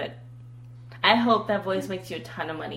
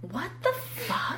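shocked; 9 semitones higher, 6 dB quieter, slower than usual. Real, not acted. Shocked at teen talk.

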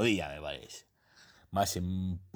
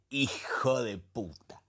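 slow breathy whisper of disbelief; whispered curse; 5 semitones higher, slower than usual. Acted. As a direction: hush it out, slow, half amazed. Nostalgic slow whisper of memory. (maldición susurrada, lenta)

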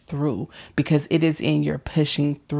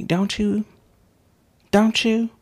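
pleading question; 6 semitones higher than usual. Real, not acted. Rising appeal to audience.

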